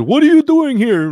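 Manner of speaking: loud, very high mock Russian accent. Ship's chief confronting rescued rowers, in a shouted Russian accent. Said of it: "like, a Russian accent".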